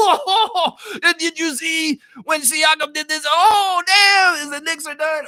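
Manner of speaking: loud, high laughing cheer; laughing, loud, high imitation of a gloating fan; mock Chev celebrating. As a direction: play a fan losing it with joy. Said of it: "imitating Chev cheering".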